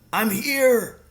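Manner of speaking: high-pitched distant call. A narrator's reading. Hidden father answers his son, calling out. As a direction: call back from far away. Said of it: "the voice called out".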